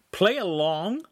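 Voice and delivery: loud, high, outraged repeat; loudly. A narrator's reading. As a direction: throw the words back in disbelief. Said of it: "Lincoln said loudly".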